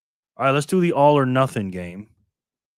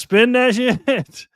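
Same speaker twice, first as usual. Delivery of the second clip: laughing; 10 semitones higher, 6 dB louder, much slower than usual. Real, not acted. Hyped spin, laughing.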